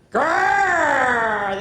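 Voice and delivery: loud high growl; hammed-up roar; dragon growl.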